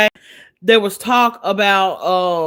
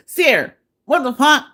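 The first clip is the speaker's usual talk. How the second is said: high-pitched mock country voice; country accent; 6 semitones higher, 3 dB louder than usual. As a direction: imitate an indignant country man on the phone. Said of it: "In his country ass voice".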